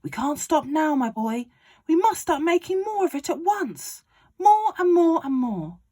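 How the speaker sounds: high, loud, gleeful father; excited Mister Cranky. Acted. Father urging George on about the medicine, high and loud. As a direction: crow it like a man with a scheme.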